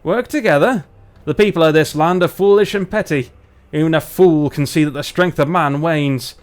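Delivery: scornful northern English dwarf; Barnsley dwarf. Acted. Dwarf dismisses the offer of alliance, scornful Barnsley accent. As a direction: scoff in a put-on Barnsley accent. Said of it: "let's do our dwarves from Barnsley".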